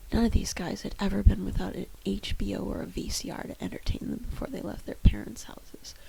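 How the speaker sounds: usual speaking voice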